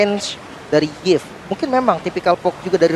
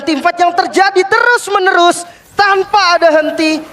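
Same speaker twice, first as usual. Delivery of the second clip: excited; 9 semitones higher than usual. Real, not acted. Caster calling nonstop teamfights.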